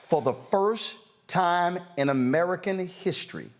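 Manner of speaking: slow emphatic statement broken by long pauses; very slow, deliberate. Real, not acted. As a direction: spell it out phrase by phrase for everyone. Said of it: "I'm gonna say this very slowly".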